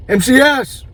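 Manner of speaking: "M C S" called out high; calling out a name. Retold or imitated. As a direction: shout "M C S" across the crowd. People calling out his names.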